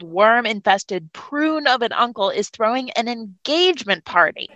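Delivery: normal talking voice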